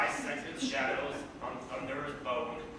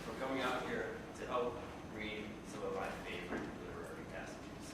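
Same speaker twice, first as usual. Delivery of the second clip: quiet; 5 semitones lower, 6 dB quieter, faster than usual. Real, not acted. Fading off mic.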